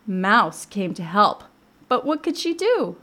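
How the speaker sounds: slow, lilting storybook narration; picture-book read-aloud. A narrator's reading. Librarian retelling Mouse's attempt to help, lilting storytelling.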